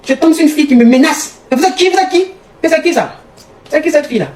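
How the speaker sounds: high-pitched, acted interrogation; mock outrage at a partner; indignant demand. Acted quarrel with boyfriend over caller.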